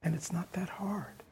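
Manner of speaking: soft confiding whisper. Real, not acted. Teacher reassuring students, whispered. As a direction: let it out as a secret.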